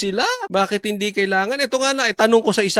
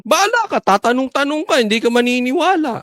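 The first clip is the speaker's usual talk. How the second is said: higher, louder knowing retort; source's retort; 8 semitones higher, 5 dB louder than usual. Retold or imitated. The source answering the host, mock dismissive. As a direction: voice the source brushing him off, high and loud. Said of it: "may sagot sa akin".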